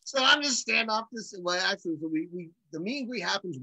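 usual speaking voice

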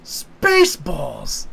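a hint of a put-on character voice, very slow; deadpan slow-motion delivery; slow drawn-out movie voice. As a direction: stretch "Spaceballs" out slowly, like a film trailer. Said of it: "one of them goes".

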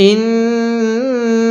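ইন্নাহা with the নুন held and nasalized; ইন্নাহা recited slowly, nasal নুন held long; ghunnah on nun. Shown as a demonstration. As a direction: hum the নুন of ইন্নাহা before the হা. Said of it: "নুনের মধ্যে অবশ্যই গুন্নাহ করব।".

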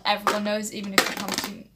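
ordinary delivery

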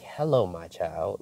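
soft, slow imitation of a false God; lightly put-on, hushed mock-holy greeting; devil mocking God. Devil posing as God to trick her, softly mocking. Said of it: "it wasn't like the stern, peaceful voice of God".

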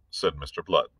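ordinary delivery

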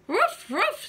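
high barking woofs; dog bark. Acted. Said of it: "said the dog".

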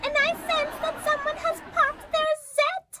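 very high, eerie monster voice, slowing to a booming curse; high creature voice threatening a girl who popped her zit. Acted. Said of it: "I am the Zit Monster".

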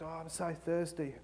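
very soft, feeble plea; small, hushed complaint; meek passenger. Acted. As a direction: plead quietly for a drink. Meek passenger pleads for a drink.

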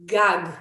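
"gag" with hard g and open ah; pronunciation demo. Shown as a demonstration. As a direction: sound out "gag" as gahg, on its own. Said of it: "So this would be pronounced".

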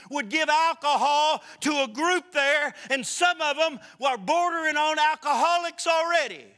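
loud, driving pulpit preaching; fiery preaching. Acted. Preacher warning his church against drink, loud and driving. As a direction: hammer each phrase home to the congregation.

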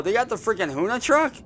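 high, fast and thrilled; can't believe it, rush it; excited disbelief. Real, not acted. Repeats news, disbelieving.

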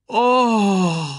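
high, loud, long-drawn "oh"; startled cry. A narrator's reading. Timon and Pumba react to the challenge, crying out. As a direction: cry out as if struck by the news. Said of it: "riefen Timon und Pumba gleichzeitig".

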